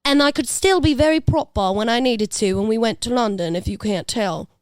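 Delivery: put-on British accent sliding toward Cockney. Shown as a demonstration. Guest showing how they mirror accents, British accent. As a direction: slip into mimicking a British accent. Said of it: "talking to me in a British accent".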